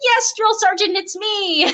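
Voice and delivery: high, amused retold reply; answering a call. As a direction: act out a trainee calling back to the sergeant. Trainee answering the sergeant's call.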